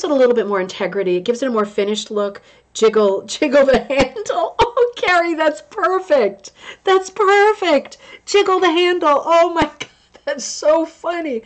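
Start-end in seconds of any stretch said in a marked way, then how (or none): 2.7-11.4 high, giggly, delighted voice; squealing with real laughter; laughing. Real, not acted. Cracking up at a viewer's joke.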